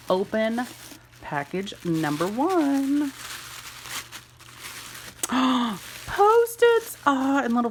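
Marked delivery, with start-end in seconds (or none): 4.6-6.0 sharp delighted intake of breath; real excited gasp. Real, not acted. Opening gift package. 6.1-7.7 high, loud delighted cry; real excited squeal; thrilled squeal. Real, not acted. Opening gift package.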